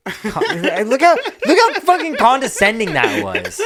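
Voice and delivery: high, loud, laughing protest; laughing outrage. Real, not acted. Host calls out friend's condescending jab, laughing loudly. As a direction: cry out through laughter, mock-offended.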